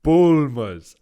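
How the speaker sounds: put-on Bulmers in a hammy Dracula accent; Transylvanian accent. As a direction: Transylvanian vampire accent, loud. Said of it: "says Bulmers in a ... vampire accent".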